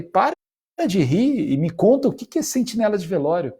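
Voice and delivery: alto e agudo, rindo junto; pedindo com insistência. Retold or imitated. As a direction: cobra a resposta com empolgação. Insistindo que ele pare de rir. (empolgado, pedindo que pare de rir)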